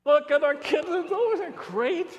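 preacher gushing at kitten photos with a laugh; cooing over kittens. Real, not acted. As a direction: melt over the cute kittens. Showing kitten pictures, cooing.

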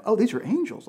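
high, ending in a breathy gasp; mock sudden awe; mock realization. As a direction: pretend wide-eyed wonder. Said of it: "there's no recognition of".

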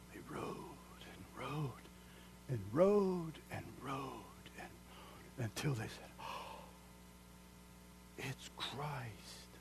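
slow, soft, trailing whisper; hushed storytelling whisper. A narrator's reading. Preacher softly telling of the wise men's journey.

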